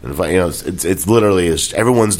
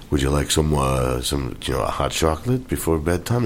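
low, soft, measured offer; mock Cloris Leachman; 5 semitones lower, 6 dB quieter than usual. Actor retells a film maid offering a bedtime drink, low and soft. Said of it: "turns around very slowly and goes".

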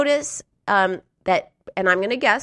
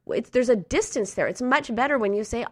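ordinary talk, nothing marked

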